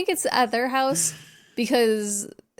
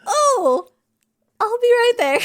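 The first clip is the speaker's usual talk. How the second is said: very high, loud sing-out reply; mock flustered call; 7 dB louder, slower than usual. Woman caught eye to eye with Mister Harrison, high mock cheeriness. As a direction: call back in an exaggerated high voice. Retold character caught out, calls back.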